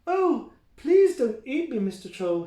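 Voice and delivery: put-on high, pleading baby goat voice; little goat pleading. Billy the Kid begs the troll, high and pleading. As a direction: beg sweetly, small and high. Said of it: "he said".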